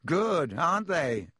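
silky, unhurried gloating; smooth. A narrator's reading. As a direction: savour it smoothly. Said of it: "said Malfoy smoothly".

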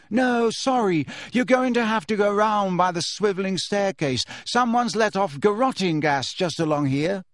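politely firm, turn them round; Ginny shooing students away; Ginny calling. A narrator's reading. Ginny turning crowd back.

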